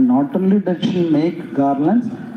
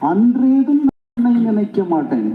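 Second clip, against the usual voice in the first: அன்றேதும் நான் உன்னை நினைக்க மாட்டேன் very fast and higher, அன்றேதும் falling; emphatic repeat; 7 semitones higher, much faster than usual. Shown as a demonstration. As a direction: repeat the line urgently, pitched up. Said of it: "That means".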